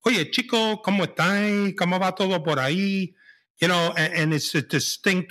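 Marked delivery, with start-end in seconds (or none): 0.0-3.1 high, singsong Mexican lilt; singsong Mexican Spanish. Retold or imitated. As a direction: sing the line like a Mexican. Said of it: "it's more like singing".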